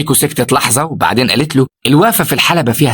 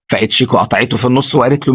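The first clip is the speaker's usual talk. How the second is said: ordinary delivery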